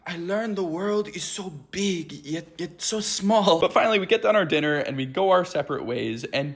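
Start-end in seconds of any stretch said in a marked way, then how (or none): 0.1-3.6 higher, slowing mock Spanish accent; pompous worldly wisdom in a fake Spanish accent; affected Spanish accent. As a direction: imitate a friend's new Spanish accent, drawing out the end. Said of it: "in her new accent".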